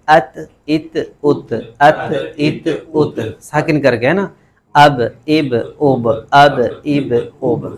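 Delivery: ات and اب cut short, letter stopped dead; sukun demo. Shown as a demonstration. Teacher showing sukun letters to students, clipped. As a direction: clip ات، اب short with a firm stop. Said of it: "اور ایسے پڑھ سکتے ہیں".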